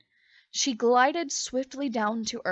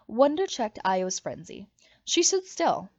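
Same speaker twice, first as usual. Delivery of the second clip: plain reading voice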